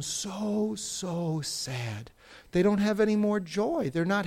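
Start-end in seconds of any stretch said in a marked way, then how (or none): 0.0-2.1 sinking sadness. Acted. Teacher describing people facing difficulty, sinking into sadness. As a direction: let the words sag away mournfully.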